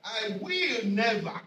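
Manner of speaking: slow, with weight on will; stress on will. Shown as a demonstration. Preacher to congregation, stressing will. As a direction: lean hard on will, slowly. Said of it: "I want you to see will".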